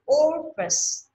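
"Office" slow, OF high and held; first-syllable stress. Shown as a demonstration. Teacher repeating for the class, high first syllable of "Office". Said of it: "Nó nhấn âm đầu".

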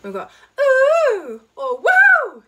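loud high slow whooping ooh; everyday high whoop; high ooh and woo. Shown as a demonstration. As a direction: whoop like an excited fan. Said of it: "We use these notes in daily life".

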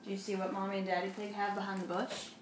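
ordinary delivery

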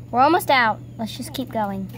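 ordinary delivery

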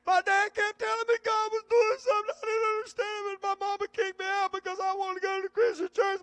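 high, loud tearful wail, imitating a young man; imitating crying. Pastor imitating William crying on the phone, high tearful wail. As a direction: cry it out through tears, retelling his call. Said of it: "he just started crying right there on the phone".

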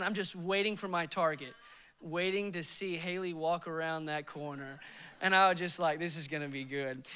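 low, soft, suspenseful murmur; hushed scheming. Retold or imitated. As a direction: keep it low as if hiding in wait. Lying in wait for sister.

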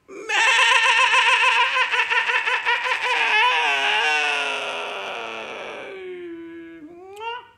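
very long, high, loud quavering baa; sheep bleat. Shown as a demonstration. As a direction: hold a huge sheep bleat till it fades. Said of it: "That's my sheepy voice".

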